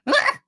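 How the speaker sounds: very high, short victim's scream; sudden high-pitched cry of alarm; startled yelp. Acted. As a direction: yelp as something grabs you. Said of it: "He's like".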